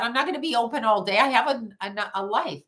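normal talking voice

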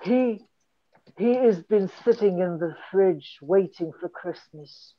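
normal talking voice

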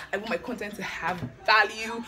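usual speaking voice

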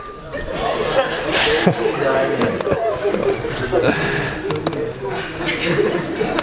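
a drawn-out, airy laugh fit; long breathless laughter. Real, not acted. Teacher laughs at the joke for a long while, breathless. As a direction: keep laughing, barely breathing.

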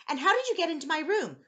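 put-on high, loud indignant child; startled question. A narrator's reading. Boy confronts intruder in his room, startled question.

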